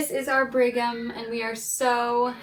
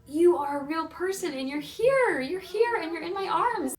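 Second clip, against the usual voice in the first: higher, wondering voice to a newborn; speaking to her newborn, higher with wonder; awed joy; 7 semitones higher than usual. Retold or imitated. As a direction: marvel at him being here.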